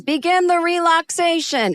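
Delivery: high, drawn announcement to the group; mock calling out. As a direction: imitate a doctor calling out instructions. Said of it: "he is calling out to us".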